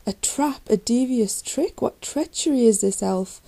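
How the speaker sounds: loud, high outraged shout. A narrator's reading. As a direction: shout in anger at the betrayal. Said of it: "cried Meldrick".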